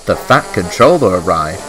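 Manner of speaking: ordinary delivery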